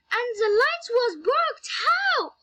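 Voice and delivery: high, startled storytelling voice; alarmed wondering. A narrator's reading. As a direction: pitch it up in alarm. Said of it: "she said in her mind".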